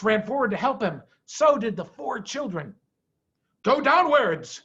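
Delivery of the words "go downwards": loud, higher command; order. A narrator's reading. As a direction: bark the order to dig. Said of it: "ordered Mister Fox".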